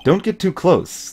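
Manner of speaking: usual speaking voice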